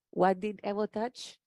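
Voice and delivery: ordinary talk, nothing marked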